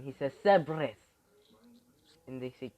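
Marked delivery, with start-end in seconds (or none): 0.4-1.0 loud call. Acted. Transformation call.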